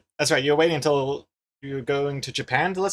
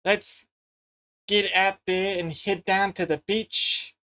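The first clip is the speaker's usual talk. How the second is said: higher, slow, careful Kiwi accent; attempted Kiwi accent; 4 semitones higher, much slower than usual. Shown as a demonstration. Streamer improvising a beach outing for chat, attempted Kiwi accent. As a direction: draw out a dodgy Kiwi accent. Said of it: "try and do a Kiwi accent".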